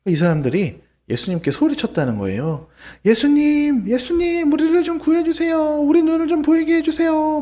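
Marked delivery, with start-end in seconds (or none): 3.0-7.4 high, loud desperate calling out to Jesus; crying out loud. Acted. Blind men calling out to Jesus, high pleading cry. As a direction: call out to him from far off in the crowd. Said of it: "막 이렇게 큰 소리로 막 외쳤다는 거예요".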